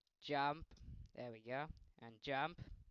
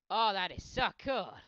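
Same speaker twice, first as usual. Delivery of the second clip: loud, higher delight; excited shout; 7 semitones higher, 16 dB louder than usual. Real, not acted. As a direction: cheer it out. Excited at stunt.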